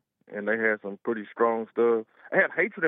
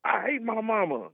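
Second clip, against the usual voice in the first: higher mocking voice of the lady; 8 semitones higher than usual. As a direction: toss off her line pitched up. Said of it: "And she's like".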